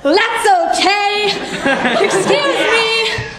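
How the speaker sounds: high, drawn-out words through laughter; hysterical laughing apology. Acted. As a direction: apologize helplessly, high, barely containing the giggles. Apologizing through a giggling fit.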